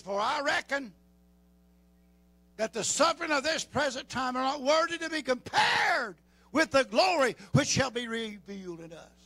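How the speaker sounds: southern preacher thundering the verse; shouted scripture. Acted. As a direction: hurl the verse out at full force. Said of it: "He says".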